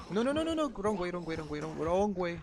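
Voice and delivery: high, rushed panic; panicked. Real, not acted. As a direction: yelp it in a panic. Panicked escape in game.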